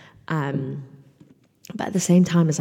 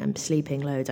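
ordinary delivery

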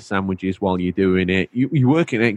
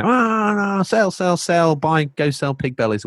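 much higher, rushed trading-floor calls; stock trader yelling; 10 semitones higher, slower than usual. Retold or imitated. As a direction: bark orders like a Wall Street trader. Stock trader shouting orders.

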